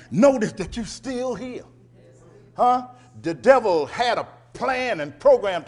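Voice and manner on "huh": usual speaking voice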